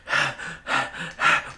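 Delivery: heavy winded breaths; mock out-of-breath gasps; acted panting. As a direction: pant as if just run in. Retold arrival after panicked running.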